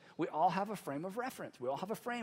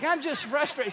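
higher, louder mock protest; heated protest; 6 semitones higher, 6 dB louder than usual. Client protesting to his counselor, heated retold protest. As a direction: insist on it with raised voice.

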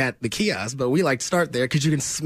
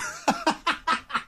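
high laugh; 14 semitones higher, 5 dB quieter than usual. Real, not acted. Laughs after his impression.

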